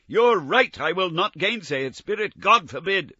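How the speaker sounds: high, loud, pleading exclamation; insisting fervently; emphatic cry. Acted. Scrooge to the Spirit, loud emphatic protest. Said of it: "cried Scrooge".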